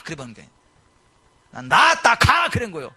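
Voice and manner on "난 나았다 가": calm claim, then a sharp high shout on 가; commanding declaration. Retold or imitated. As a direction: bark the final 가 like an order. Commanding the bone to go.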